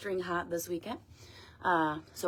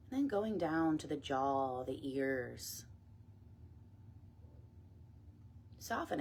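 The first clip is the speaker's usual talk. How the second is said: guided meditation; 6 dB quieter than usual. Acted. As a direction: ease them gently down, phrase by phrase. Leads meditation and breath work.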